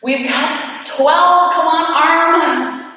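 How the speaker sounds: slow, pushing through the burn; straining effort. Real, not acted. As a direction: strain out each word as the arm fails. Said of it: "my arm's, like, wobbling all over the place".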